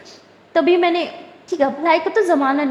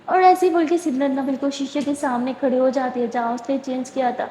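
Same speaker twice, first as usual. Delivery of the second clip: plain reading voice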